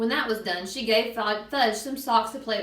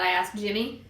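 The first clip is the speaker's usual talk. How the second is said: usual speaking voice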